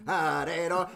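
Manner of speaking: ordinary talk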